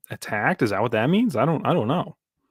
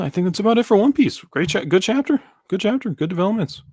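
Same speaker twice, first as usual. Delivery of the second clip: upbeat; 9 semitones higher, 4 dB louder than usual. Real, not acted. Upbeat sign-off.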